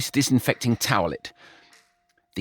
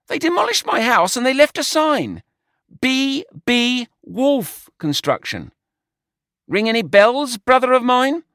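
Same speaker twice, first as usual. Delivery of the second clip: much higher, louder, indignant; outraged George; 13 semitones higher, 5 dB louder than usual. A narrator's reading. George telling Brian about the wolf sign, indignant. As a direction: spit it out in disbelief.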